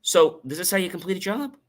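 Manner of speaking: high, rising, interested question; approving question. Shown as a demonstration. Coach liking what you're doing, approving. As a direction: lift it all the way up, as if liking it. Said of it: "I might be liking what you're doing".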